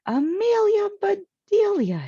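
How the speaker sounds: high, loud shocked shout; shouting a name. Acted. Missus Rogers, seeing the tree, shouts high and loud. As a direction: yell her name in alarm. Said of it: "she shouted".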